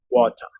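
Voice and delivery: "Water" with the final R as a schwa. Shown as a demonstration. Teacher giving an example of British English, schwa in place of final R. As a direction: end "Water" on an unstressed "uh", British style. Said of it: "cuando tenemos una R al final, suena como una schwa".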